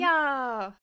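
loud, eager yes; enthusiastic. Real, not acted. Co-host offered a story reading, loud enthusiastic agreement. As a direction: jump at the offer with real enthusiasm. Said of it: "das war schön enthusiastisch".

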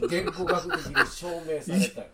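proven by linguistics, laughing. Real, not acted. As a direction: insist through laughter. Mock authoritative claim, laughing. (笑いながら断言)

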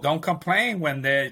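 ordinary talk

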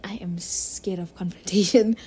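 hushed, breathy confession to the viewer; whisper. Real, not acted. She whispers her fear of confrontation to viewers. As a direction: whisper it like a guilty secret.

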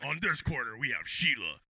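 low announcer introduction; mock ring announcer. Announcer introducing a fighter in her corner, mock ring announcer. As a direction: present the fighter to the crowd.

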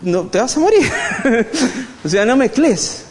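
high, laughing mock alarm; Anto's warning. Anto stops him mixing the cutting board, high laughing alarm. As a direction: squeal it like a worried partner half laughing. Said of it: "me dice".

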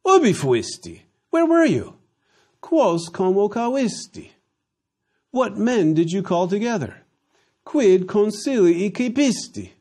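high, brisk back-and-forth of Latin and English; quick translation run. Acted. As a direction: rattle through the questions and their meanings.

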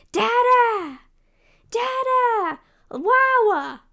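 high, drawn-out calls; toddler yelling. Retold or imitated. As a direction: imitate a little kid hollering for family across the house. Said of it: "she started yelling for Sid and Delilah".

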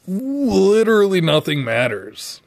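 hammed-up, stretched-out dismissal; emphatic mock dismissal. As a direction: draw out the first word in mock exasperation. Acted dismissal of a worry.

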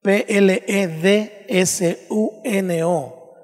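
Spanish letter names, said one by one, slowly; spelled out. Shown as a demonstration. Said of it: "Lleva el acento en la U".

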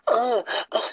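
hammed-up breathy sex moans; high imitated moaning; mock moaning.

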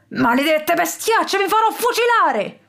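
high, mock-furious villain's shout; furious yell. A narrator's reading. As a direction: rage at the birds, hammed up. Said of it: "urlava su tutte le furie il criminale". (urlo furioso e acuto)

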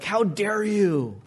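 slow, drawn-out indignant gasp; mock outrage. Wife teasing herself over the toothpaste tube, mock outrage, very slow. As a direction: drag it out in pretend horror. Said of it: "and be like".

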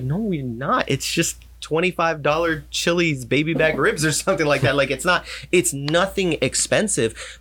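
dismissive dad. Retold or imitated. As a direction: laugh off the worry as no big deal. Father brushing off daughter's worry.

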